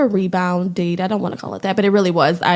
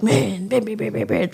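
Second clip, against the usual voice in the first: imitated banging. Imitating his angry banging.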